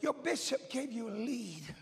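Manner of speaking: ordinary talk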